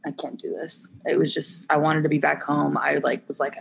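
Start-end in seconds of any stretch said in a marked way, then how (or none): 0.1-0.9 whispered despair. Retold or imitated. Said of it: "I was like".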